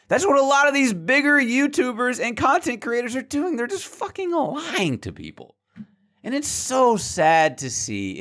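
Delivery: high-pitched, indignant outburst; exasperated rant. Real, not acted. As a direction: call out the liars in real exasperation. Denouncing creators who lie.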